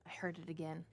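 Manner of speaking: nervous undertone on hearing something; scared. Real, not acted. Streamer alone in a scary game, frightened hush. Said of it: "That scared me so bad".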